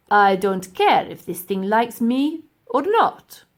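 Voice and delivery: loud, slow dismissive grumble; grumpy professor. Acted. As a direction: brush it off gruffly.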